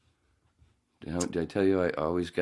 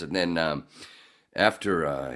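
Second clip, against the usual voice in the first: ordinary talk, nothing marked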